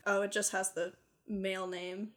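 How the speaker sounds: ordinary talk, nothing marked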